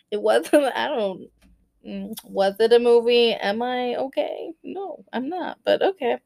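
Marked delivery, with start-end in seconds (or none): none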